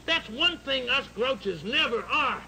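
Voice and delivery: ordinary delivery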